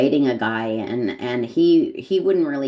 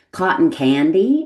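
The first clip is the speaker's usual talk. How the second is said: put-on drawn-out, louder American accent on a question; higher, incredulous American-accented echo; 4 semitones higher, 6 dB louder than usual. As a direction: play up the American accent, slowly. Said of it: "in my American accent".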